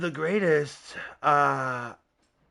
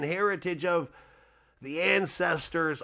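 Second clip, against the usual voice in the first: ordinary talk, nothing marked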